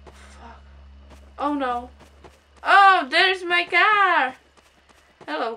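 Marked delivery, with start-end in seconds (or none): none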